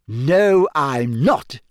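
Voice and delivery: delighted, emphatic comeback; beaming. A narrator's reading. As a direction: insist it with a beaming grin.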